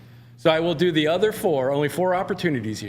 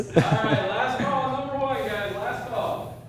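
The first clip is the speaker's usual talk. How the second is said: high, raised last call; 8 semitones higher, slower than usual. Real, not acted. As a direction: call out to the bidders. Auction host calls last bids.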